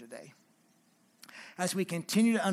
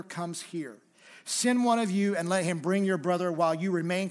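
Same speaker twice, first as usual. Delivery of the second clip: a stern ruler accusing, read with some force; stern Joseph; 4 semitones higher, 8 dB louder than usual. Acted. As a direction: press the charge of spies. Reads Joseph's stern accusation aloud.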